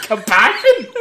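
high, loud incredulous echo; mock disbelief. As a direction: throw the word back through laughter. Mock disbelief at co-host's boast.

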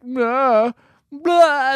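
high, loud fake vomiting noise; mock retching. Co-host imitates seasick characters on the boat, mock retching. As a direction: imitate throwing up, hammed-up. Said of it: "and they throw up everywhere".

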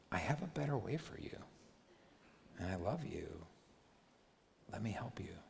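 soft, tender fatherly words, with pauses between; gentle reassurance. Acted. God reassuring someone who has failed, gentle and soft. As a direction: reassure quietly, letting the words trail softly. Voicing God's loving reassurance.

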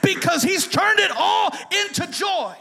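high, loud, slow shouted exhortation; preacher crying out to the congregation; preaching at full force. Acted. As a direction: shake the room loose with it.

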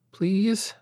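put-on high, small begging child's voice; a tiny plea, eyes wide; pleading little girl. A narrator's reading. Daughter hugging her father's neck, begging him.

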